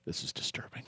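soft unvoiced mock-spooked whisper; whispered aside. Host spooked by a mystery noise in the studio. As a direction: whisper it as if something lurks nearby.

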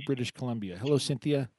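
ordinary talk

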